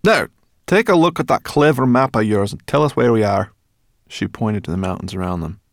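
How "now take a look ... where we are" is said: high, loud, brisk character voice; brisk Sorrel. A narrator's reading. Sorrel tells Ben whom to thank, brisk and high. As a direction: pipe up loudly and briskly.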